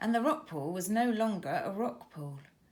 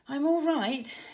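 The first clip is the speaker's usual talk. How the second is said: shaky; 6 semitones higher, 5 dB louder than usual. A narrator's reading. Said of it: "he said shakily".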